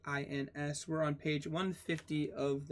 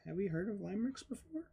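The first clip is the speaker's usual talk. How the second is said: quick high aside; 5 semitones higher, 4 dB quieter, much faster than usual. Real, not acted. Playful teacher aside.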